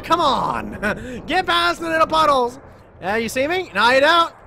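loud, high panicked excitement; frantic chase yelling. Real, not acted. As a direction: shout while running for your life. Fleeing the enemy in game.

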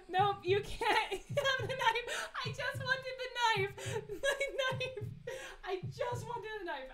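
high, loud wail of protest; worked-up wail. Real, not acted. Player denied the knife in a scary game, wailing. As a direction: cry out in frustrated, laughing protest.

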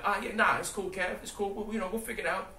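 high, loud, forced-casual brush-off; fake breezy cheer. Brushing off Kev's bow-out, hiding disappointment, with forced cheer. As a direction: mask the letdown with a bright high shrug. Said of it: "but outward, I'm like".

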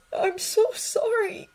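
high, slow, tearful apology; a boy whispering sorry through tears; whispered apology. Acted. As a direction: breathe it out, broken by guilt. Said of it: "he whispered".

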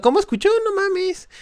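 very high, loud outcry; outraged disbelief. Real, not acted. Gamer ambushed by a waiting enemy, squeals high.

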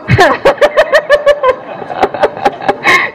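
long, high wheezy laugh; laughing fit. Real, not acted. Teacher joking with the class mid role-play, laughing fit. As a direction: burst out laughing and keep going.

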